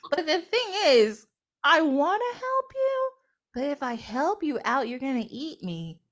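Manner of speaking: high, wheedling little voice; acted coy hesitation; coy reluctance. Spider coyly bargains with leopard.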